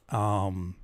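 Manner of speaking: ordinary talk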